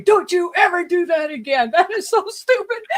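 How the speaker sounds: high, loud mock scolding that breaks into a laugh; retold scolding yell. Friend retelling how she was yelled at, high loud half-laughing scold. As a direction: playfully imitate a friend yelling at you. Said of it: "were screaming at me".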